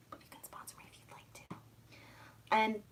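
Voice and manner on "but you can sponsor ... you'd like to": a sly whispered plea for sponsors; conspiratorial aside to the audience. Real, not acted. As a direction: whisper it cheekily.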